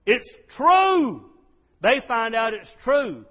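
high, very loud, drawn-out cry; jubilant shout. Real, not acted. Preacher announcing the camp is real, jubilant shout. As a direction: shout the discovery, holding the last word long. Climax of the story, joyful discovery.

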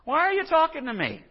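indignant Samaritan woman. Retold or imitated. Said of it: "She's saying".

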